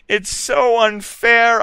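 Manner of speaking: high, loud, slow wail; yelling out her unfairness; yelled. A narrator's reading. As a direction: whine it loud to the sky. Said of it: "I yielded".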